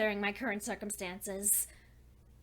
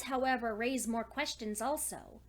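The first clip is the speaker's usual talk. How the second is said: ordinary delivery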